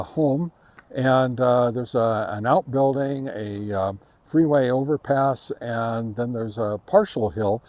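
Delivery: ordinary delivery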